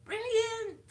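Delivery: high, loud faked northern catchword; failed northern accent. Vlogger attempting the actress's catchword, failed northern accent. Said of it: "that's not northern accent either".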